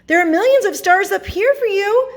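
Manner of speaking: high, loud storybook invitation; eager offer. A narrator's reading. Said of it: "she offered".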